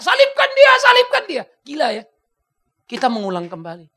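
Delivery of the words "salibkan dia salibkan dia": high loud cry of crucify him; crowd shout. Retold or imitated. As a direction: shout it like the mob. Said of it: "Aku teriak". (teriakan lantang "Salibkan dia")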